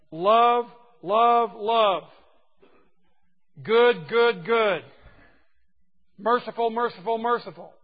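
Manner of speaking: each word called out loud and held; emphatic repetition. Shown as a demonstration. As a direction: hammer each repeated word like a proclamation. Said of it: "the Hebraic way of emphasizing a word".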